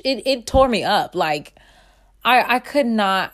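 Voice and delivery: ordinary delivery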